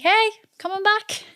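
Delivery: breezy greeting. Retold or imitated. Telling friends she is coming back, breezy greeting. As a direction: call out a cheery hello.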